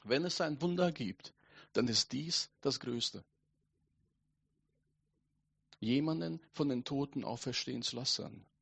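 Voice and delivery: soft, solemn pulpit delivery; hushed preaching. Real, not acted. Preacher tells congregation of the greatest miracle, hushed and solemn. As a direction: let the words fall softly, with long pauses.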